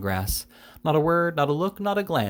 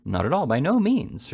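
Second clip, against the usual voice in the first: higher, quick-to-deny reassurance; unabashed denial, pitched up; 6 semitones higher than usual. A narrator's reading. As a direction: brush the charge aside without shame. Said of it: "replied the unabashed Mister Jingle".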